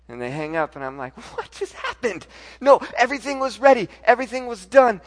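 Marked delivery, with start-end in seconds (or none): 1.4-5.0 high, loud outburst of disbelief; re-enacted frantic objection; distraught protest. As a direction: protest in a panic, pitch jumping up. Acting out his stunned protest.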